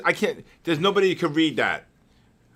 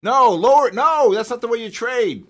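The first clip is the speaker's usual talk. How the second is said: loud, higher insistence; insistent correcting; 8 semitones higher, 7 dB louder than usual. Real, not acted. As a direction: cut in and correct firmly. Scolding trader on stops.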